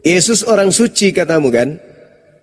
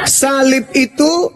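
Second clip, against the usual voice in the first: mocking drawl; 8 semitones higher, much slower than usual. Real, not acted. Mocking drawn-out repeat.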